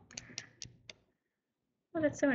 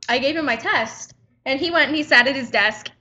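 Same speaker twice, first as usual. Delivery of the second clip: ordinary talk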